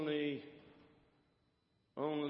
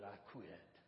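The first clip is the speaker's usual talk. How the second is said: low, very soft admission; hushed, low old-dog reply; old greyhound; 6 semitones lower, 16 dB quieter than usual. Acted. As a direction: confess it quietly and simply. Old greyhound's quiet confession.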